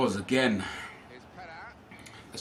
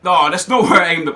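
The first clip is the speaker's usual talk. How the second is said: loud, higher angry yell; furious protest; 7 semitones higher, 9 dB louder than usual. Real, not acted. Gamer furious at the game sending his pass astray, loud angry yell. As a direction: bark it at the game in real anger. Pass goes wrong again.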